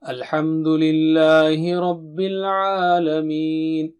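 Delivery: slow, relaxed Quran recitation; imitating the imams' easy chant. Shown as a demonstration. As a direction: recite it calm and natural, no strain. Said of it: "they recite like this".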